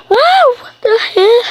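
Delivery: high, loud startled cry; play-acted alarmed outburst; pretend shock. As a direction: yelp as if the thunder made you jump.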